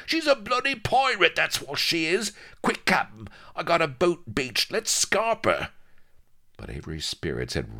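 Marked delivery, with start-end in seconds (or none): 0.1-5.7 high-pitched urgent yelling; violent cry. Acted. Vladimir warning his captain about the pirate ship, crying out violently. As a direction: yell in alarm to get away fast. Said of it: "cried Vladimir violently".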